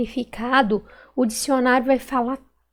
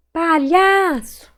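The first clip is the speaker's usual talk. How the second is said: "Palhaço" slow, with a high long LHA; stretched stressed syllable; 4 semitones higher, 3 dB louder, much slower than usual. Shown as a demonstration. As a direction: call out "Palhaço", holding LHA. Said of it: "Qual que eu demorei mais falar".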